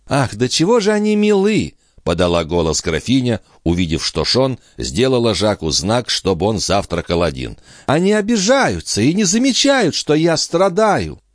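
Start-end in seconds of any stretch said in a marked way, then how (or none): 0.1-1.7 high, aggrieved sarcasm; sarcastic countess. A narrator's reading. 7.9-11.2 aggrieved countess. A narrator's reading. Countess complains she suffers.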